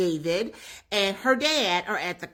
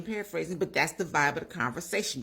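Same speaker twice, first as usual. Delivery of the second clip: ordinary talk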